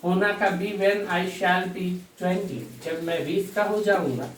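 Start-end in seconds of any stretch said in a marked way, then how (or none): 0.8-2.6 very slow, each word set apart; teacher dictating a wrong example slowly; slow dictation. Shown as a demonstration. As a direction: read out the wrong sentence slowly. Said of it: "शैल".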